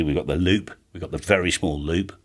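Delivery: ordinary delivery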